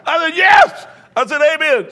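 very high, loud whoop; hammed-up shriek of agreement; mock triumphant shout. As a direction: yell it out in comic triumph. Retells his triumphant reply to mother.

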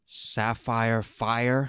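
slow, rising question of disbelief; slow incredulous. Acted. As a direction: drag it out, incredulous. Zuko repeats again, incredulous.

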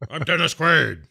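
high, hammed-up actor voice; mock movie-star delivery; Dennis Quaid. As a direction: introduce yourself in a strained heroic voice. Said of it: "talking like this".

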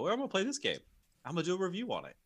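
high, soft mocking voice; lightly voiced know-it-all; mock casual gamer voice. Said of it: "pull out of his ass and just go".

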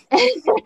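a quick high laugh after the joke; short laugh. Real, not acted. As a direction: laugh it off, amused. Laughs at own sass.

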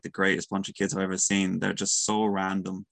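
normal talking voice

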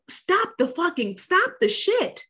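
exasperated rant. Real, not acted. Ranting at men.

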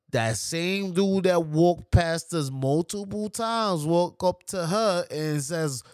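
ordinary delivery

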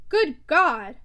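loud bursting exclamation; outburst. A narrator's reading. Stephen bursting out at Maggie, loud outburst. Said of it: "he burst out at last".

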